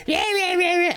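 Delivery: very high-pitched mock VTuber babble; cartoonish imitation of a screechy streamer; screaming VTuber impression. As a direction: squeal like an over-excited streamer, mockingly. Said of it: "So they do this".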